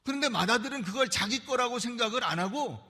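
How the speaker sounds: ordinary talk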